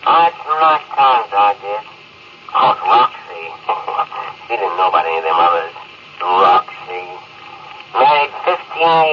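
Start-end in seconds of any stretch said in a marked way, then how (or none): 3.5-4.4 much softer breathy chuckle; tender private chuckle; soft breathy laugh. Acted. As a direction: laugh fondly to himself. Lester remembering Roxy.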